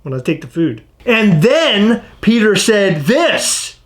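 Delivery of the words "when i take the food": low, soft villain voice; hushed supervillain impression; Doctor Evil. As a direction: play a movie supervillain, quiet and low. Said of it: "I'm Doctor Evil when I do it".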